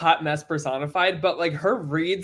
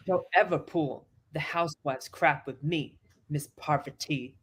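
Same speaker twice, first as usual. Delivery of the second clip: quoting a housewife; 2 semitones lower, 4 dB quieter than usual. Retold or imitated. Quoting show, softer other voice.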